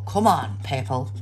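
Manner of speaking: normal talking voice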